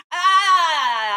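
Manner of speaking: loud laughing shriek. Real, not acted. Bursts out laughing.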